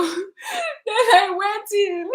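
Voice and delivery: high, drawn-out tearful wail; crying. Real, not acted. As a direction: squeeze it out slowly through tears. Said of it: "I'm crying because the story is so touching".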